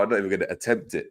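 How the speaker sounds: ordinary talk, nothing marked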